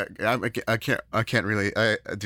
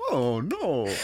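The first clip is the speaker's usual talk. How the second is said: mimicking line. Repeats the line.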